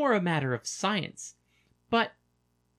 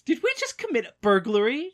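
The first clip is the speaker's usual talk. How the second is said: astonished; 7 semitones higher, 5 dB louder than usual. Acted. Astonished laughing question.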